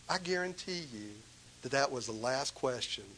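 usual speaking voice

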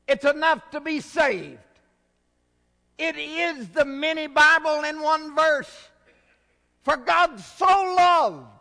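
loud, high, ringing preaching; forceful preaching. Acted. Pastor proclaims God's love to the congregation, loudly. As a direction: preach John three sixteen with full force.

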